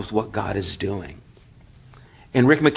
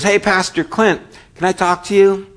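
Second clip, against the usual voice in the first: put-on higher, eager teen voice; eager car wash boy; 8 semitones higher, 3 dB louder than usual. Car wash boy asking Pastor Clint for a word, eager and higher. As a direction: pipe up like a keen kid at the door.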